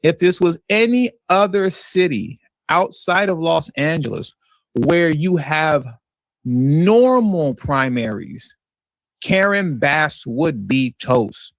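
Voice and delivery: slowed down, each phrase set apart by pauses; slow emphatic repeat. Shown as a demonstration. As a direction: lean on "normal primaries" slowly so it sinks in. Repeats clip line for emphasis.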